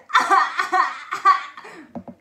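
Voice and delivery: loud laughing burst. Shown as a demonstration. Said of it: "Is that how you would cry?".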